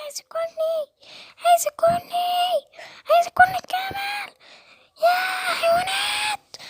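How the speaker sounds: very high, crying squawks for help; a hen running for her life, screaming; shrieking chicken begging the animals for rescue; panicked chicken. Acted. Chicken fleeing the camel.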